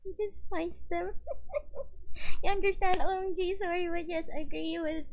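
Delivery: high-pitched talk through giggles; real giggling. Real, not acted. Streamer agreeing with chat about ugly Labubus, laughing. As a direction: squeeze the words out between laughs.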